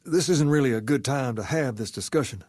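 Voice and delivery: ordinary talk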